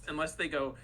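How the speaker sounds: usual speaking voice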